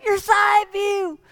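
put-on high, slow, strained old-man voice. Old man lying under the Ferrari, high, slow and strained. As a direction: squeeze it out like a frail old man.

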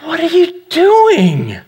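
high, slow, stretched-out mock disbelief; baffled wife. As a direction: imitate a wife asking in bewilderment. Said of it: "she goes".

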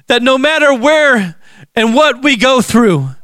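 ordinary delivery

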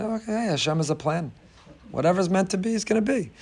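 soft, unruffled shrug of faith; quietly resigned and untroubled; calm acceptance. Acted. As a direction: drop the volume, accept it peacefully. Acting out calm acceptance amid alarm.

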